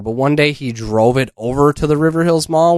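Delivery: ordinary delivery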